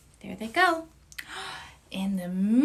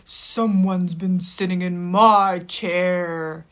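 lower, slow, heavy papa bear voice; gruff Papa Bear; 6 semitones lower, slower than usual. Acted. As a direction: play the big stern father bear. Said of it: "Papa Bear said".

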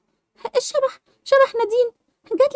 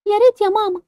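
ordinary talk

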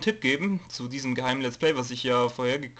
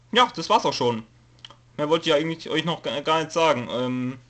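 ordinary delivery

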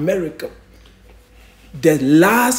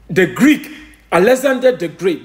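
ordinary talk

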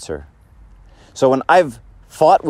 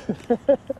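high laugh; 11 semitones higher, 6 dB quieter than usual. Retold or imitated. Laughs mid retold retort.

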